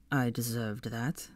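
slow, quiet and low; quiet, slow grim admission; grim murmur. Acted. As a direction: mutter it grimly. Malfoy smiling grimly.

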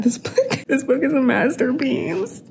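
laughing through the words, high; giddy laugh of delight. Real, not acted. Reader gushing about the book, laughing.

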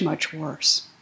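ordinary delivery

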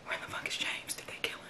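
tense hushed whisper. Real, not acted. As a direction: whisper in the dark, afraid. Tense scene in the dark.

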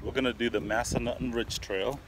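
usual speaking voice

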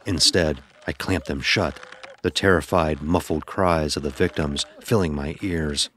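ordinary delivery

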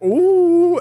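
high, loud teasing ooh; a big swooping ooh to tease us; mock ooh. Captors hooting at the bagged recruits, high loud ooh. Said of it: "they're like".

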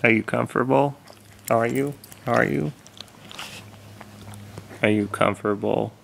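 high, sing-song pet voice. Real, not acted. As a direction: coo softly at a sleepy dog. Cooing at the old dog.

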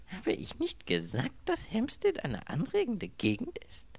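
murmured question climbing very high; rueful murmur. A narrator's reading. Duped man recalling his own words, rueful murmur. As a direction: remark it ruefully to yourself. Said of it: "murmelte Anthony".

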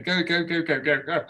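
normal talking voice

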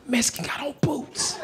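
urgent, voiceless stage whisper; whispered warning. Acted. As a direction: hiss the secret warning under your breath. Whispered warning, played once more.

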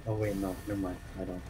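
ordinary talk, nothing marked

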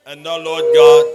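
normal talking voice

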